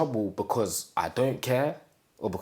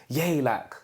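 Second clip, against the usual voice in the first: put-on a child's happy cheer, pitched up; excited yay; 6 semitones higher, 3 dB louder than usual. Retelling waking up to no school, bright excited cheer. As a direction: cheer like a kid let off school.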